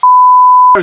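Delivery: loud, high burst of laughter. Real, not acted. The group laughs at the joke.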